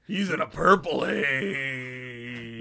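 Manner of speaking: slow, drawn-out DJ voice; mock radio DJ. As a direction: stretch it like a cheesy radio DJ. Mock DJ segue, slowed.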